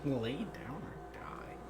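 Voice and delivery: slow, very soft whisper; hushed, drawn-out mock menace; whispered telepathic echo. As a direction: whisper it slowly like a voice in the head. Echoes the telepathic command.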